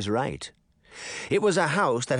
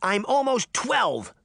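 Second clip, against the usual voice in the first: higher, slower indignant shout; 11 semitones higher, slower than usual. A narrator's reading. Girl shouting back at the mayor, indignant. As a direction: protest hard, every word. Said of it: "Sabrina shouted".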